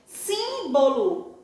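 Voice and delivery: "Símbolo" slowly, leaning on a long SÍM; stressed first syllable. Shown as a demonstration. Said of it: "Antepenúltima é a sílaba mais forte". ("Símbolo" devagar, com a primeira sílaba forte)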